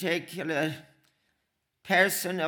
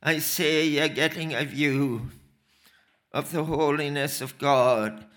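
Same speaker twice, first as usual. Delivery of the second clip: ordinary talk, nothing marked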